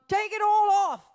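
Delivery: high, loud, slow cheeky heckle; hammed-up burlesque heckler; mock saucy catcall. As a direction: play a rowdy fan shouting "take it all off". Mock striptease call from fan.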